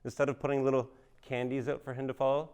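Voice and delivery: ordinary delivery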